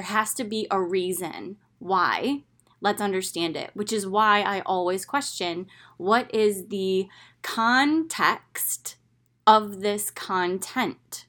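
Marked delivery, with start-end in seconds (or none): 6.0-11.2 slow, each word laid down; deliberate teaching pace. Shown as a demonstration. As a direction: spell out the question slowly. Repeating her guiding question.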